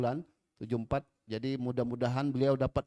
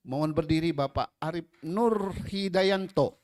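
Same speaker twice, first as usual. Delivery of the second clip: loud, high ceremonial announcing; announcer; 6 semitones higher, 5 dB louder than usual. Acted. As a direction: introduce each guest with flourish. Emcee introduces guests one by one.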